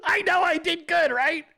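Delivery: high eager voice, beaming at the compliment; giddy proud groom. Retold or imitated. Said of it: "You're like".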